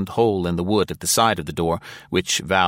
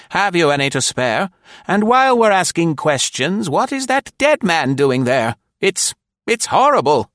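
higher, louder matron demanding answers; imperious grande dame; 11 semitones higher, 6 dB louder than usual. A narrator's reading. Nora questioning the stranger about the body, imperious. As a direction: press him with lofty questions.

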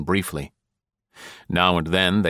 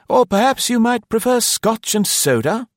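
eager host; 5 dB louder than usual. A narrator's reading. Eager host offers a drink.

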